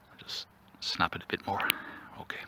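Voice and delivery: hushed whisper while snapping a twig. Real, not acted. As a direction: breathe the words out softly, almost no voice. Cracking a twig to calm nerves.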